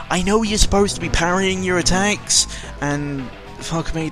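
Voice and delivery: normal talking voice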